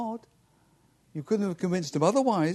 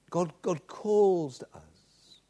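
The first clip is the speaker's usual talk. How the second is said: usual speaking voice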